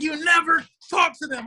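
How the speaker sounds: high-pitched mock telling-off; strained, high comic reprimand; exasperated scolding. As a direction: imitate a lawyer appalled at his client. Lawyer scolds client in story.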